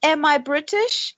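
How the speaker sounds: put-on high, slow try at a British accent; British accent attempt. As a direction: have a hesitant go at sounding British. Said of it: "I can't do her accent".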